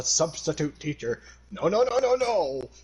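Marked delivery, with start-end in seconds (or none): 1.5-2.7 high, rising protest of no's; hammed-up, high-pitched refusal; mock horror. Mock refusal of senpai talk.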